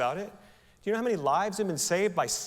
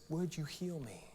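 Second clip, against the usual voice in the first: much softer, hushed plea; quiet plea; 12 dB quieter than usual. Acted. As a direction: ask humbly, barely above a breath. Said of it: "the beggar said to Jesus".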